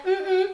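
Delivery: high, loud wordless "mm, mm"; imitated child's demanding grunt. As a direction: grunt insistently like a child asking Dad. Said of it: "si le decía". (quejido agudo y fuerte de niño que pide)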